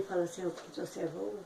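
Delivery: hushed, voiceless prayer; without voice. Retold or imitated. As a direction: let the voice nearly vanish. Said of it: "sem voz até".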